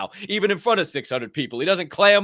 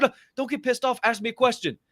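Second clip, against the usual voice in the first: higher, insistent coaxing; encouraging producer; 8 semitones higher than usual. Retold or imitated. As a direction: push him kindly to ask. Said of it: "I said".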